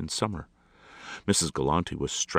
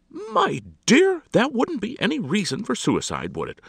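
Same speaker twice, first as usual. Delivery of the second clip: high, loud, condescending; hammed-up incredulous lady; dismissive society hostess; 7 dB louder than usual. A narrator's reading. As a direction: scoff archly at a friend.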